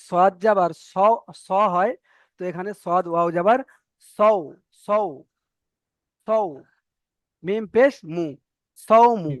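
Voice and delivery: sing-song spelling of সৌমু; recital drill. Shown as a demonstration. Teacher answering a student by chanting.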